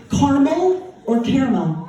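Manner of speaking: "caramel" first said as two-syllable "carmel", then as "care-a-mel"; two pronunciations. Shown as a demonstration.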